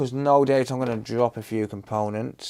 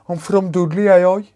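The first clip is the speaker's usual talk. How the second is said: put-on higher, louder broad Dudley accent; 6 semitones higher, 8 dB louder than usual. Streamer showing chat how Dudley folk talk, hammed-up Dudley accent. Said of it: "That's how they talk there".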